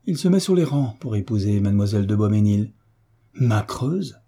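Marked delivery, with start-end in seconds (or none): 3.4-4.2 mildly startled, questioning exclamation; astonished exclamation. A narrator's reading. Olivier hears who the suitor is, astonished exclamation. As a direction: echo the name in surprise. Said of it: "s'écria Olivier".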